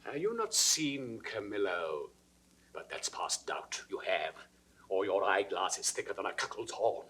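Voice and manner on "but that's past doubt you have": hushed, pointed accusation; whispered certainty; menacing whisper. Acted. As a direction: drop to a threatening whisper. King confronts Camillo.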